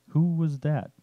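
put-on an old man wondering who is calling his kid away, fatherly and concerned; doting parent concern. A narrator's reading. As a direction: ask like a worried dad. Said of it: "concern of a doting parent".

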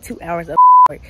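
loud exasperated outburst. Real, not acted. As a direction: blurt it out loud. Venting about lost edit.

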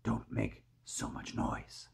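soft plea sinking into a whisper; speaking softly. A narrator's reading. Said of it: "James said softly".

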